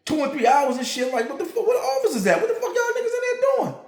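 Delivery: high, laughing disbelief; retelling his shock to a CO friend, high and laughing; incredulous laughing. Retold or imitated. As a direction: ask it like you can't believe it, half laughing.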